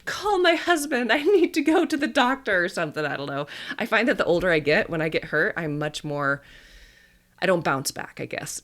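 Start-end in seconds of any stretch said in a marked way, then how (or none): 0.0-2.6 overly dramatic. Pretend. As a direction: ham up a tiny injury as a disaster. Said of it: "quite dramatic and been like".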